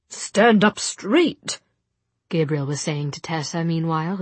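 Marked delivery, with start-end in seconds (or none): 0.1-1.6 slow, loud, drawn-out order; impatient command. A narrator's reading. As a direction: drill it out, word by word.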